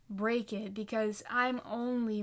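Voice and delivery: ordinary talk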